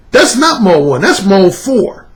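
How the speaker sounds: ordinary talk, nothing marked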